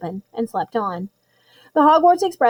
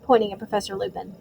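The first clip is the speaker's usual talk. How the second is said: usual speaking voice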